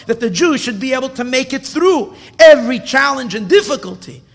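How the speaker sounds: slow, loud insistent sermon; rousing preacher's refrain; fervent preaching. Acted. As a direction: hammer each line home with fervour. Preacher's climax.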